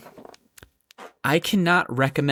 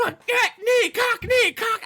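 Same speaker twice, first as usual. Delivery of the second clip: high-pitched mock-frantic outburst; frantic mimicked clues. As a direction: blurt each clue like a desperate charades player. Mimics brother's frantic charades clues.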